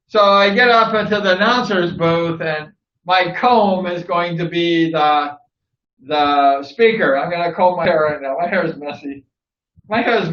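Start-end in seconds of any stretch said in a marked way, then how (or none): none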